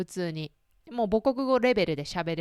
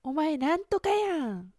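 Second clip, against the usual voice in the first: slow, raised mock Kansai-ben; Kansai dialect quote; 8 semitones higher, slower than usual. Friend teasing in put-on Kansai dialect, slow mock lilt. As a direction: draw out the Kansai ending やん. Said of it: "とか言って言われて".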